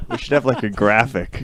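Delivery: usual speaking voice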